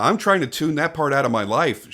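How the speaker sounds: normal talking voice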